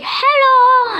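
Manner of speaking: very high, loud chirpy greeting; tiny mini-me. Retold or imitated. Mini version of himself popping out, very high and chirpy. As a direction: squeak it out like a tiny cheerful double. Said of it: "he was like".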